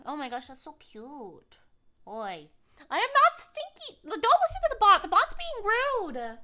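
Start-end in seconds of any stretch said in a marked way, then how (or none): 2.9-6.4 loud, higher mock indignation; indignant protest. Real, not acted. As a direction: insist loudly you're not stinky. Bot called her stinky.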